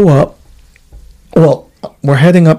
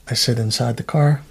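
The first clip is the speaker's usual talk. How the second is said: normal talking voice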